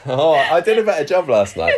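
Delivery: usual speaking voice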